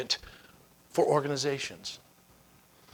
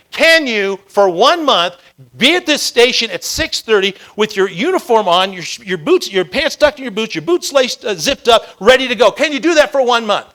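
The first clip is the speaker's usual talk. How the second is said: very loud, raised, hammered-out demand; insistent challenge; 5 semitones higher, 11 dB louder than usual. Retold or imitated. Mentor repeats his challenge to the reluctant firefighter, insistent. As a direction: press every condition home at high volume.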